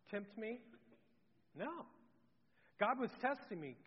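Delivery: normal talking voice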